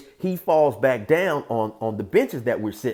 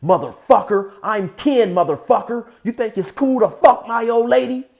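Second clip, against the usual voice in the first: loud, high-pitched furious challenge; acted angry yelling; angry confrontation; 11 semitones higher, 8 dB louder than usual. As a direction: shout it like a jealous man squaring up.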